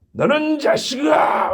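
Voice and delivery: slow, high barked shout; drill sergeant. Retold or imitated. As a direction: bellow it like a drill instructor. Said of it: "교관은 어떻게 해요?".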